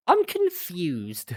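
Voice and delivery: loud, high, drawn-out bafflement; baffled cry, stretched right out; exaggerated confusion. Real, not acted. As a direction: wail it slowly in utter confusion. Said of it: "I'm really confused".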